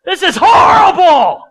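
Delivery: high, loud, slow outburst of frustration; venting complaint. Acted. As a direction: shout it out, fed up and indignant. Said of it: "I would vent".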